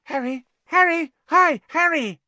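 high, loud, eager calling; awestruck schoolboy. A narrator's reading. Second year greeting his hero Harry, excited. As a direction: call out like an adoring young fan. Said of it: "deeply in awe of Harry".

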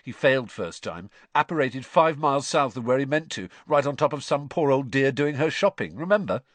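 mildly gleeful teasing; grinning. A narrator's reading. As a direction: relish telling a brother's mishap.

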